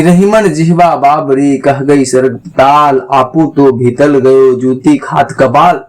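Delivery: recited couplet. Shown as a demonstration.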